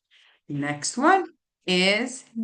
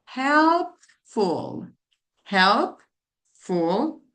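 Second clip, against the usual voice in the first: ordinary talk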